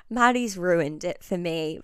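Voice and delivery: ordinary talk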